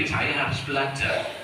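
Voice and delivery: normal talking voice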